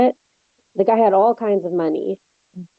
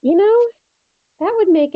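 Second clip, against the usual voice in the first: sudden excitement; 10 semitones higher, 3 dB louder than usual. Acted. Excited by sequel idea.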